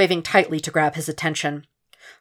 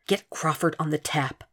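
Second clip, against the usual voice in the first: hushed, softer urgent order; urgent whisper; 6 dB quieter than usual. Acted. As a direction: barely breathe the order to the secretary. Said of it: "was all he could whisper".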